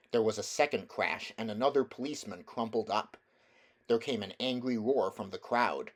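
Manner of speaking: ordinary delivery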